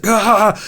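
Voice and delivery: loud, high imitated roar of frustration; mock rage yell. Boy stuck on the final boss, mock rage yell. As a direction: yell in rage, half laughing. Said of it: "out of rage".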